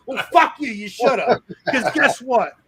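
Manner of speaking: high, loud put-on outrage; mock angry yell. Boss shouting back in the staged quarrel, loud and high. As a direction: yell back as if truly offended.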